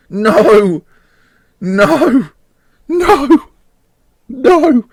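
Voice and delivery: high, loud anguished no; despairing cries. Real, not acted. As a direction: cry out in disbelief at each blow. Last-minute goal conceded, despairing.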